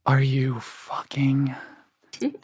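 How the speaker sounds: slow, whispered disbelief; stunned swearing under the breath; disbelieving whisper. Real, not acted. Whispered reaction to a dice roll.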